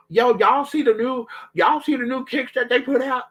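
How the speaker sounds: put-on high, eager man-child voice; mock him like an excited kid; mocking cousin. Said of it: "acting like".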